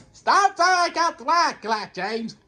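high, loud, drawn-out scolding; frustrated shout. Pretend. Gamer telling himself to stop, high frustrated shout. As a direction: shout it out in exasperation, slowly.

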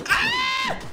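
very high scream; death scream. Real, not acted. Gamer killed by the boss, high scream. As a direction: shriek as you die.

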